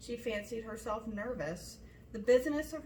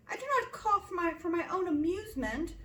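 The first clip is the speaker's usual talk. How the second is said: put-on higher, fretful young girl; 9 semitones higher, 6 dB louder than usual. A narrator's reading. Daughter answering father's jibe, fretful and higher. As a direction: whine back, hurt and peevish. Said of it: "replied Kitty fretfully".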